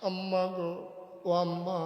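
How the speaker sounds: lightly acted child's plea; crying child. Hungry children calling their mother, mild crying plea. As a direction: call for mother, a touch tearful. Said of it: "বাচ্চাগুলা কান্দে আর কয়".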